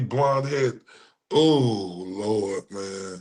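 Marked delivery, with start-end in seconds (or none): 1.3-3.1 weary groan. Real, not acted. Exasperated groan after rant.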